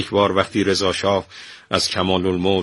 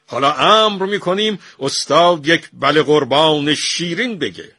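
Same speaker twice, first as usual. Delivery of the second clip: high, forceful royal anger; furious warning; 9 semitones higher, 5 dB louder than usual. A narrator's reading. Shah warns the refusing painter, furious. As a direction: order him to obey, voice raised in anger. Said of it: "شاه که از عصبانیت سرخ شده بود گفت".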